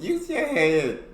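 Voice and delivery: high slow repeat of the line; imitating the quip. Repeating the clip's joke, high slow echo. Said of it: "Man who headbutted".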